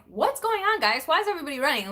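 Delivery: high, loud bewildered call to the family; startled shout to the running house; confused calling out. Retold or imitated. Retold confused call at the door.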